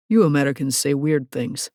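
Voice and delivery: a hint of a Russian accent, taking his time; light Russian accent. A narrator's reading. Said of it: "his rich accent quite delicious".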